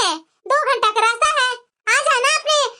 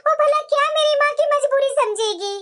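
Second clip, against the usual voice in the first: ordinary talk, nothing marked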